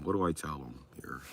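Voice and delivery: drops to a hushed aside; furtive muttered question. Acted. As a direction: ask the council low and secretive. Asks the council aside.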